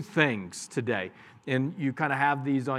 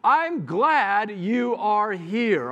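slow, higher and bright welcome; warm greeting; 6 semitones higher, 4 dB louder, much slower than usual. Shown as a demonstration. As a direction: model a glad greeting slowly. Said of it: "Greet them and say".

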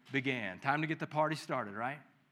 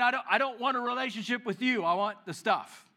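higher, quicker, dismissive son's voice; cold rejection; 7 semitones higher, 3 dB louder than usual. Retold or imitated. Younger son brushing off his father, demanding the stuff. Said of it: "very tense, tough conversation".

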